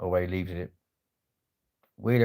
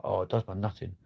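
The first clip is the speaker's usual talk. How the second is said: usual speaking voice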